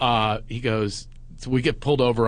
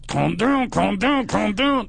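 higher, squeezed, repeated hushing; ventriloquist voice; 8 semitones higher, slower than usual. Retold or imitated. Comic quieting his panicking friend before the cop, ventriloquist voice. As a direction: plead through barely moving lips. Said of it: "that corner of your mouth ventriloquist".

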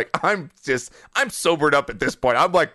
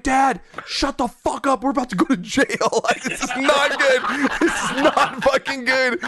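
higher-pitched mock panic at Dad; retold exasperated scolding; 8 semitones higher than usual. Son retells warning dad about jail, exasperated. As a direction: scold in a high, half-laughing voice.

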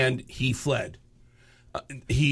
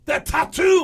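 high raspy rocker; 14 semitones higher, 6 dB louder, slower than usual. Retold or imitated. [name] pointing out tattoo.

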